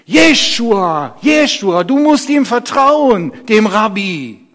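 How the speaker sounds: hoch und laut gerufen; lautes Rufen. Acted. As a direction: rufe es beschwörend über die Menge. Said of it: "andere riefen". (eindringlich gerufen)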